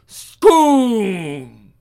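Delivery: a breathy whoosh, mouthed loud; whooshing sound effect. Acted. Narrator mouths a whoosh as Finn strikes. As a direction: make the swoosh of the attack.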